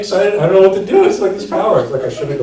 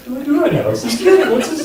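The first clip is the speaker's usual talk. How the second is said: giddy panic; 11 semitones higher, faster than usual. Retold or imitated. Retold excited inner thoughts.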